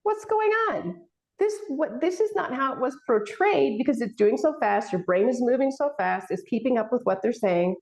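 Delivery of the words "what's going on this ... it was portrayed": surprised. Retold or imitated. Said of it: "you're like".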